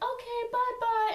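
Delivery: high, drawn-out sing-out; put-on sweet send-off to visitors; mock cheery goodbye. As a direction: stretch out a high-pitched farewell. Seeing visitors out, voiced.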